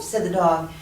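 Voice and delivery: ordinary delivery, nothing marked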